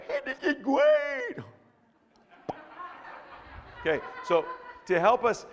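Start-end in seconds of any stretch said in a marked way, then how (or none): 0.1-1.4 much higher, slow and gleeful; carefree kid. Acted. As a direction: play a delighted kindergartner. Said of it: "No, they're like".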